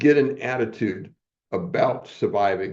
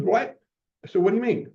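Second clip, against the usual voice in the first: startled what; 11 semitones higher, faster than usual. Retold or imitated. Startled reply to teacher.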